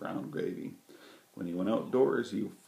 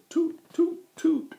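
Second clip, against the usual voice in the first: very high, loud whistle toot; high piercing toot toot; train whistle toot; 9 dB louder, slower than usual. A narrator's reading. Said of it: "The train whistle said".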